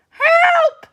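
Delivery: very high, loud squawk of a hen; a frightened hen cries out; clucking hen. Acted. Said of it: "she clucked".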